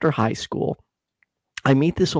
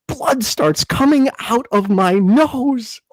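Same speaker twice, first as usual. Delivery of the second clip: normal talking voice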